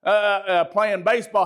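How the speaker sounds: ordinary talk, nothing marked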